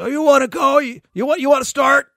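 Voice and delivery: high, loud mock taunt; belligerent challenge. Drunk fans challenging Voyar, mock belligerent shout. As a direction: imitate a fan spoiling for a fight.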